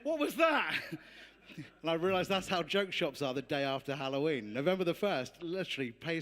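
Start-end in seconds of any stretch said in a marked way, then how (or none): 0.0-0.7 shop worker. Retold or imitated. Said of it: "as they're going".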